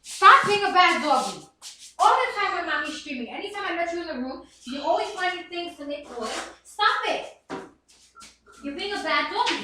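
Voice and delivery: sharp, firm scolding of a pet; scolding the dog. Real, not acted. Streamer scolding her noisy dog mid-stream. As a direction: tell the misbehaving dog off.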